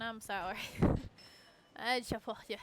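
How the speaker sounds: ordinary talk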